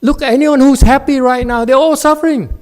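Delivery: loud high angry yell; furious master yelling. Real, not acted. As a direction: shout the student down.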